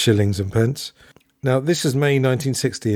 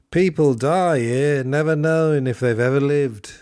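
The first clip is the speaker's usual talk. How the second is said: put-on John's accent; 4 semitones higher than usual. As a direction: roughly imitate John's accent, stretching the words. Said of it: "a rough approximation of John's accent".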